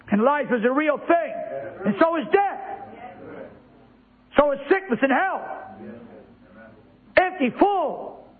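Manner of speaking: high, building proclamation; preaching fervour. Acted. Preaching climax.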